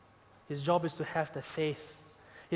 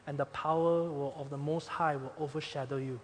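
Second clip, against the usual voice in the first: a soft, gentle angel voice, quieter and trailing away; speaking very gently; 5 semitones lower, 8 dB quieter than usual. Acted. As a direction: tell her tenderly, easing her fear. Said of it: "Gabriel spoke very gently".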